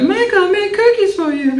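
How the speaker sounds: put-on squeaky high little girl offering cookies. Boy imitating a little girl bringing cookies, squeaky high. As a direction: pitch way up like a sweet kindergarten girl. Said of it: "And she goes".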